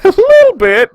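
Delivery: laughing. Real, not acted. Laughing at own messy tournament.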